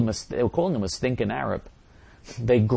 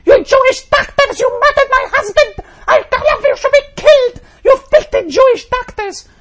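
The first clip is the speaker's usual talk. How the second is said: sarcastic hypothetical shriek, far higher and louder; Nancy Reagan screaming; 12 dB louder than usual. Pretend. Host mocking the CNN comparison, shrieking as a grieving widow. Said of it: "Nancy Reagan was screaming".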